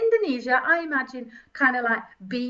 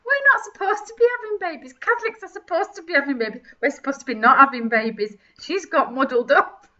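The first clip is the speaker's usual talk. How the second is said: ordinary talk, nothing marked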